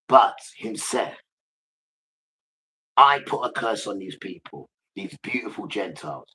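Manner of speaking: loud, slow punches on key words; emphatic preaching as God. Acted. God explaining the curse to followers, forceful and emphatic. Preaches in Father's first person.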